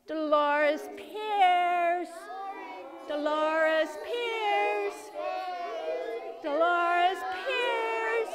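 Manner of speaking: high, slow drawn-out call; yelling mom's name. Shown as a demonstration. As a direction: call the name across a store, slowly. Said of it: "you're gonna yell your mom's first name".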